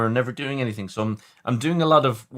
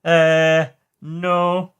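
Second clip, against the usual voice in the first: louder mock Einstein refusal; hammed-up Einstein saying no; 6 semitones higher, 6 dB louder than usual. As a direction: do a quick Einstein impression. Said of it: "And Einstein goes".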